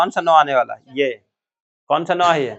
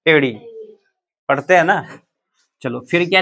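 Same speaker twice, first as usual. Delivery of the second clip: usual speaking voice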